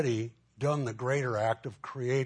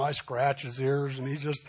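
ordinary talk

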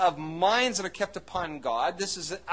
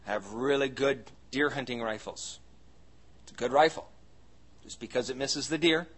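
normal talking voice

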